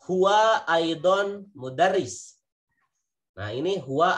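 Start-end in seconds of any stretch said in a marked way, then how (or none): 0.1-2.4 slow, louder and higher example sentence; slow demonstration. Shown as a demonstration. Teacher slowly modelling an Arabic example sentence. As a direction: say each word slowly and clearly. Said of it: "Ana mudarrisun, saya seorang guru".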